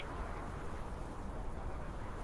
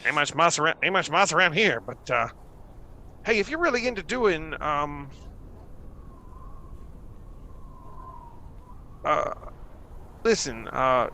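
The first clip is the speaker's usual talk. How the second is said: higher, sly invitation; lightly voiced gnome; 6 semitones higher, faster than usual. Gnome inviting the stranger up to his room, high and sly. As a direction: lure him aside conspiratorially.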